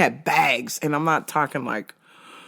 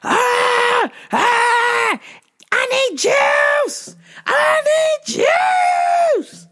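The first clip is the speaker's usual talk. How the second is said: shrieked much higher and louder, slow; frantic shrieking demand; 5 dB louder, much slower than usual. Retold or imitated. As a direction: wail for juice like a madwoman. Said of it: "started pounding on the desk".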